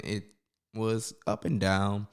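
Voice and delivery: ordinary talk, nothing marked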